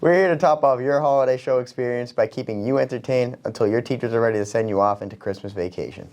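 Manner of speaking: ordinary talk, nothing marked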